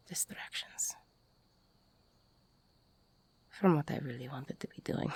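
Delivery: soft quick whisper close to the ear. Acted. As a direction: breathe it right into their ear.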